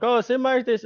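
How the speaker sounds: high-pitched imitation of complainers; put-on high voice, poking fun at critics; mocking naysayers. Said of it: "now people are like".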